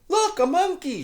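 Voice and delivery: put-on high excited girl's shout; a little girl pointing and shouting. Girl in story hour shouting at a monkey, high and loud. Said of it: "shouted a girl".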